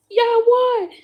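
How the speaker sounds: high, loud announcing yell; excited shout. Retold or imitated. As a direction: shout the big news to the crowd. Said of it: "[name] yelled out".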